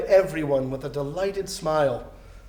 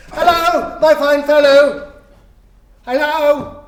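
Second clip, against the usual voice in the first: much higher, loud laughing hail; 9 semitones higher, 8 dB louder, slower than usual. Acted. Scrooge hailing the boy from the window, loud and laughing. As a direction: sing out a jolly greeting.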